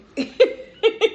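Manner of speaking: high, loud burst of laughter. Real, not acted. Telling viewers the doctor was unhappy, loud laugh.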